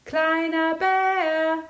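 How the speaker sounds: slow, high, loud sung call; sing-song call. Acted. Big bear can't find him, sing-song call. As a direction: sing the name out across the hills. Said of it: "rief der große Bär".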